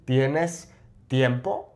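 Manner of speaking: "tiempo" rising at the end; measured Spanish question, upturn on "tiempo"; question intonation. Shown as a demonstration. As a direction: split the words, then lift "tiempo" as a question. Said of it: "change the intonation".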